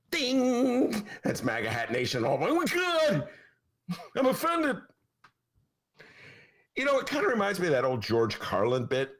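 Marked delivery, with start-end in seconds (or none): 0.1-1.0 mock ding. Mock lightbulb moment. 2.2-4.8 high, slow, hammed-up shock; mock offended gasp, much higher; mock outrage. Mocking offended maga listeners.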